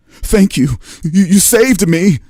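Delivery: high, loud stammering thanks; overwrought, high-pitched relief at being rescued; frightened gratitude. Acted. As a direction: stammer out thanks like a scared governor. Scared governor thanks his rescuer.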